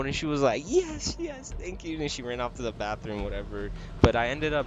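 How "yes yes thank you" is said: much higher, squeaky mock of a flattered woman; mock flustered lady. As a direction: pitch it way up like she's caught off guard. Mocking flustered customer reply.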